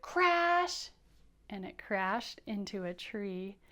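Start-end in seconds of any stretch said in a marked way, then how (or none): 0.0-0.9 a high, loud "crash"; teacher acting out the crash; mock crash. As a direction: shout it like a crash. Plane crashing in story.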